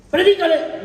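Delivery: insistent repeated command. Pretend. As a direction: hammer "predícale" the second time.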